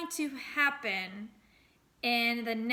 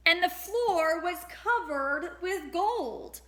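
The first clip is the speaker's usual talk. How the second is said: amazed reveal; 6 semitones higher, 4 dB louder than usual. A narrator's reading. Magical reveal in story.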